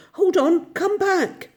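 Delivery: high, loud call after a friend ahead; urgent joyful hail; excited calling. A narrator's reading. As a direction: shout eagerly to stop him. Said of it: "he called, full of joyful excitement".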